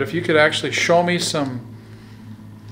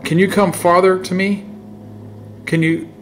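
louder, higher call; 5 semitones higher, 7 dB louder than usual. Real, not acted. Ghost hunter calling out to the spirit, louder. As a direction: call out into the dark.

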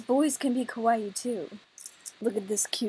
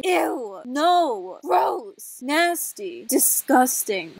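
higher, drawn-out revulsion; mock disgust; 4 semitones higher, 3 dB louder, much slower than usual. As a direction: reject each word with theatrical disgust. Acting out rejection of his confession.

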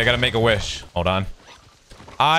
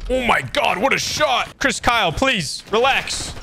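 excited high yelp; 11 semitones higher, slower than usual. Real, not acted. Celebrating a great shot.

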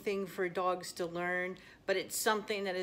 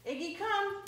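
high-pitched recall call; real, high dog-training command; 13 semitones higher than usual. Real, not acted.

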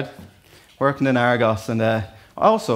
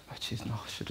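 mock whisper; 3 semitones lower, 18 dB quieter than usual. Mimics schoolboy dodging homework question.

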